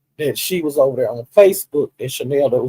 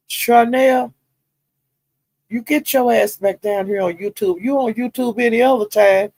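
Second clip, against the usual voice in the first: a retold holler pitched up, only half-hearted; mock yell; 7 semitones higher than usual. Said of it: "She's screaming that".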